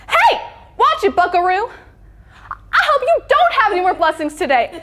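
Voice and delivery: high, loud Texan mom scolding; mock road rage. Mom yelling at a swerving motorcyclist, mock road rage. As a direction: holler it like an indignant but polite mom. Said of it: "watch her have road rage".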